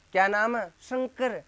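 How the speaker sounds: mock the wrong pronunciation; wrong dental s; wrong s in Shankar. Shown as a demonstration. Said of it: "तो ये तो नस्ल है".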